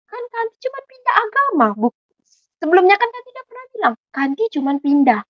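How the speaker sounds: high-pitched pleading objection to mother; defensive protest. Retold or imitated. Protes membela diri kepada ibunya. (protes membela diri dengan nada tinggi)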